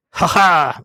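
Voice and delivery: loud, high jolly laughter; booming festive laugh; hearty laugh. A narrator's reading. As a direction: laugh out big like a merry young man. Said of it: "laughed Scrooge's nephew".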